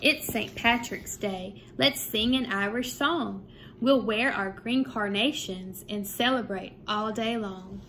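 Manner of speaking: lively sing-song picture book reading; bouncy storytime voice with a lifted refrain; reading to children. A narrator's reading. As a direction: read the rhymes aloud to a second grade class. Reads picture book to class.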